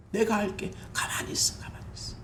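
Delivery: soft, fading whisper; hushed voice of God. Retold or imitated. God reassuring His child, hushed whisper. God's voice quoted.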